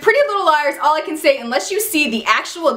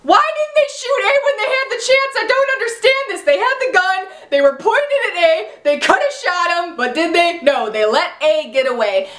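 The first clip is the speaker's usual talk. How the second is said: high, indignant disbelief; exasperated rant; 7 semitones higher than usual. Real, not acted. A fan ranting at the show, high exasperated disbelief. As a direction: vent at the writers, incredulous.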